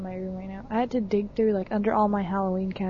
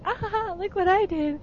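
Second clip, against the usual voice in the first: high-pitched triumphant gloat; 9 semitones higher than usual. Retold or imitated. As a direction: crow it proudly, mimicking her big moment. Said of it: "the look on her face is, like, so triumphant".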